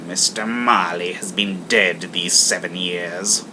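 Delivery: put-on grim old man voice; cold Scrooge. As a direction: flatly state the death.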